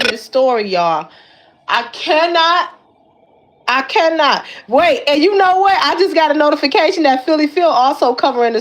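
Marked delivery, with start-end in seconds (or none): none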